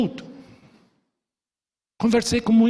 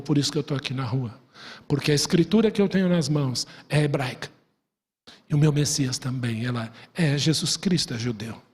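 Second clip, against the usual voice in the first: soft retold talk; 5 semitones lower, 3 dB quieter than usual. Conversa íntima retomada.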